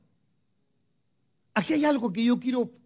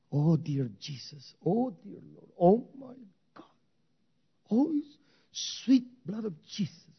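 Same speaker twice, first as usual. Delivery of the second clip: mock pious gushing; 3 dB quieter than usual. Mimics flattering pious exclamations.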